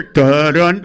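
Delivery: low, loud "dun, dun"; imitated suspense sting. Comic imitating the sound he suddenly hears, mock ominous. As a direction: voice the ominous music, deep and loud. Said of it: "All of a sudden I hear".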